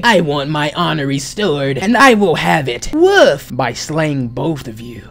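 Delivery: emphatic, declaiming villain; villain vow. Acted. Villain vows to slay both heroes, menacing.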